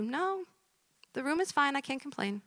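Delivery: high, soft little patient voice; small, uncomplaining put-on voice; resigned patient. Same patient, second reply.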